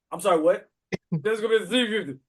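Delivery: a hint of the mumbling mechanic; light imitation of a mumbling mechanic; lightly voiced mechanic. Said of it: "he says the whole word in one syllable".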